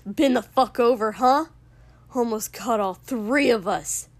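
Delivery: loud, incredulous angry tirade; furious rant. A narrator's reading. Furious man berating someone for not fighting back, enraged. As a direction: hammer each accusation home. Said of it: "looking utterly furious".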